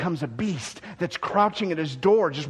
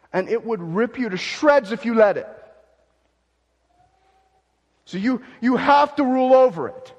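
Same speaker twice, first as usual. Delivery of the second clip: urgent warning; 7 semitones higher, 8 dB louder than usual. Acted. God warning Cain, voice rising.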